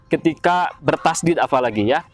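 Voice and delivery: ordinary delivery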